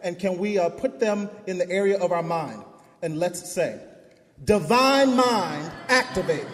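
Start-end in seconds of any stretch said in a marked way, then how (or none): 4.4-6.5 slow, loud chant, DIVINE drawn out and stressed. Shown as a demonstration. Preacher leading congregation's first repeat, loud stress on divine. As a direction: lead the chant leaning on divine. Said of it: "the first time it'll be divine".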